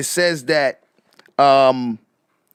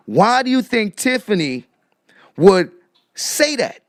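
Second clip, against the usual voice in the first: ordinary talk, nothing marked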